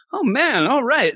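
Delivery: voiced up high, nervous; a lightly voiced anxious girl; high character voice. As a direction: pitch up for the character. Voicing a character's reaction.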